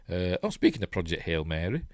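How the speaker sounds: ordinary talk, nothing marked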